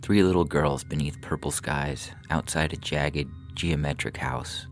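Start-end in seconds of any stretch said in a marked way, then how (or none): none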